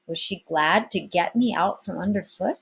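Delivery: plain reading voice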